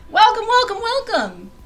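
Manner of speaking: high, loud double welcome; excited welcome. Real, not acted. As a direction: throw the welcome out big. Welcoming viewers to the stream.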